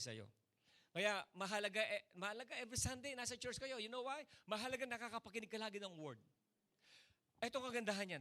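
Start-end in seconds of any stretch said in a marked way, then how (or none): none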